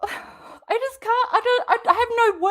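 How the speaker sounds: high, fast, laughing in disbelief; helpless laugh of disbelief; exasperated laugh. Real, not acted. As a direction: laugh it off, speechless. Host lost for words.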